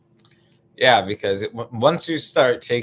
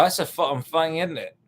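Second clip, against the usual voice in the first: mock British accent, pitched up; 6 semitones higher than usual. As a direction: do a cheeky British "isn't it". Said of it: "if your accent".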